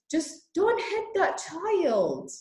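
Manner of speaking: high, slow, pleading voice; emotional plea. Real, not acted. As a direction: beg them slowly, voice lifting high. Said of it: "I am very emotional about it".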